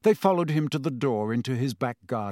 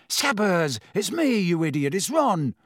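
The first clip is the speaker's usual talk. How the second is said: higher, urgent hissed scolding; exasperated hiss; 5 semitones higher than usual. A narrator's reading. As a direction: hiss impatiently at a struggling pet. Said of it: "Ron hissed".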